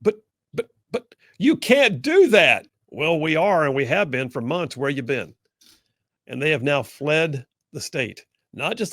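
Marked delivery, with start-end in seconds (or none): 0.0-2.6 high, slow, whining protest; mock the lawmakers' sputtering outrage; whiny Democrats. Host mocking Democrats' protest, slow high whine. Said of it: "are just now going".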